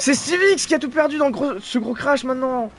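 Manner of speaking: high, loud shocked call of the crash; shocked outburst. Real, not acted. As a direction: blurt out the crash in disbelief. Reacting to big crash live. (stupeur en direct)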